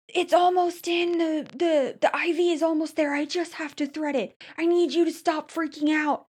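impatient nurse yelling mid-IV, replayed; retold nurse yelling. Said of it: "literally yelling at me".

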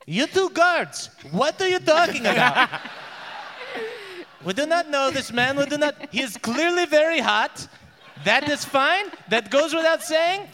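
high, exasperated royal voice; the king flusters, pitched high; flustered king. Acted. King scolding guards about the prisoner, high and flustered. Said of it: "the king goes".

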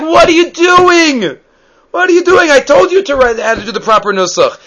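hammed-up outraged shout; mock furious husband. Husband exasperated at the wife on the second night, mock shouting. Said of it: "I was like".

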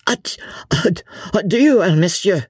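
put-on high, choked stammer in a French accent. A narrator's reading. Insulted Vicomte chokes with wrath, stammering. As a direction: choke on the first syllable with wrath. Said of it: "he stammered".

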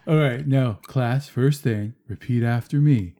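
lightly voiced slow surfer-dude teacher; Keanu teaching. Mocking a Mad TV sketch of Keanu teaching a class. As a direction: drawl it like a laid-back Keanu teacher. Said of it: "He goes".

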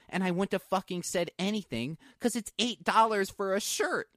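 normal talking voice

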